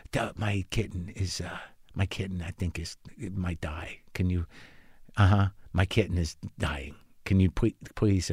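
a low, mumbled mock-plea cut off mid-word; mumbled inner panic. Owner's unspoken thought while the vet reminisces, mumbled low and soft. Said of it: "Meanwhile, I'm like".

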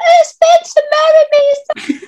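very high, loud, drawn-out swoon; imitating a friend's love-struck shriek; mock infatuated squeal. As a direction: squeal it like a smitten fan, hammed up. Mimics friend's smitten excitement.

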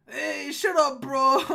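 loud, laughing gnome comeback; loud retort. Acted. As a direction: shout down the teasing, then laugh. Gnome snaps back at teasing.

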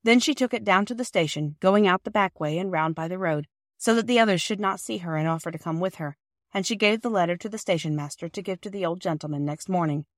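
ordinary delivery

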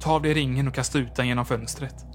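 plain reading voice

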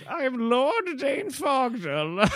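high, slow, booming mock lord. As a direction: proclaim yourself like a pompous overlord. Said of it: "It's like being like".

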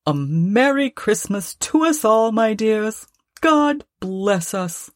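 a hint of a fond father's toast; Bob's hearty toast. A narrator's reading. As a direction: propose a toast to the family, drawn out at the end. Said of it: "Then Bob proposed".

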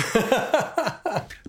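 high burst of laughter; high-pitched chuckle; amused laugh. Real, not acted. Laughs at the email he quoted.

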